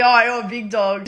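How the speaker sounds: loud imitation of a matey greeting; re-enacted over-friendly holler; hearty backtracking. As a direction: boom it out as a compliment.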